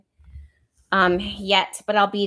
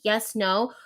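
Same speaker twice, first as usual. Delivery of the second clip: ordinary talk, nothing marked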